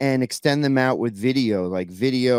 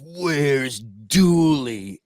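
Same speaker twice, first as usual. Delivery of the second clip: drawn-out, very slow wrestler impression; Macho Man; much slower than usual. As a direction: stretch each word like a menacing wrestler. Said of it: "Macho Man comes up to me and he goes".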